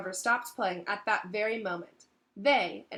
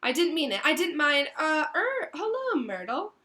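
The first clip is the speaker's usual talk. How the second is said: high, louder nervous stammer; flustered backtracking; 8 semitones higher, 6 dB louder than usual. A narrator's reading. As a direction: stumble awkwardly into a greeting. Caught out, backtracking awkwardly.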